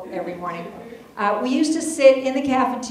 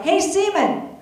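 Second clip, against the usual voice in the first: high, loud hallway call-out; mock bully yell; retold yell; 10 semitones higher, 4 dB louder, slower than usual. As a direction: shout it across the hall like a loudmouth. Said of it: "Phil Tarantino yells out".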